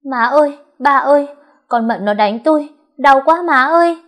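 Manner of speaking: high, loud theatrical wail; wailing tantrum. Acted. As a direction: fake sobbing for attention. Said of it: "ăn vạ khóc lóc thảm thiết".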